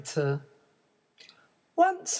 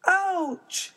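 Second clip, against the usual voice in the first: high sharp yelp; ouch; 14 semitones higher than usual. Acted. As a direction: yelp at the hard seat. Hard chair.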